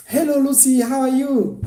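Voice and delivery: high, sing-song mock greeting; mock sweet greeting. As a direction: parody a needless hello. Mocking a time-wasting greeting.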